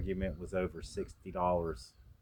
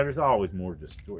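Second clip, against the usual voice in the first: ordinary talk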